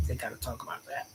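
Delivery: breathy whispered aside, barely voiced. Shown as a demonstration. Streamer whispering a gossip aside to viewers. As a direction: drop to a secret whisper.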